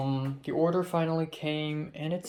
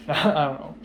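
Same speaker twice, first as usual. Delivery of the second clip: repeats it through a laugh; 7 dB louder than usual. Real, not acted. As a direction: chuckle the words out. Muttering to self while eating.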